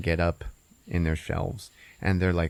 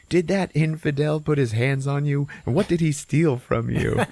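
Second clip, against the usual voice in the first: higher, interrogating voice; raised-pitch hammed-up indignation; indignant food character; 6 semitones higher, 3 dB louder than usual. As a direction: demand answers in mock outrage. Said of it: "some other Middle Eastern food".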